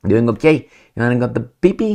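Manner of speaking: put-on high, sing-song baby talk; high cooing voice for a toddler. Shown as a demonstration. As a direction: coo at a little kid like a parent. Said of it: "you're not gonna talk like that with a toddler".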